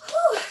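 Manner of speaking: high, sharp whew; exhale whew. Real, not acted. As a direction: puff out after the set. Exhale after squats.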